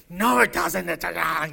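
loud, sharp imitated rebuttal; mock heated objection; indignant retort. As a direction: throw back an angry denial. Witnesses retorting in retold argument.

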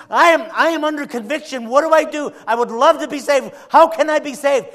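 high, loud anguished pleading; desperate caller. Retold or imitated. Desperate caller begging the radio host, high and loud. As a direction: cry out to be saved. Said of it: "this guy was, was desperate".

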